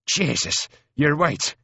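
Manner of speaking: high, astonished Irish cry. A narrator's reading. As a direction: exclaim it high in a rough soldier's voice. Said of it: "cries he".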